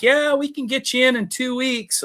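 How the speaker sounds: lightly mocking service desk voice; offhand service guy. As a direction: mimic the clerk who brushes him off. Service guy brushing him off.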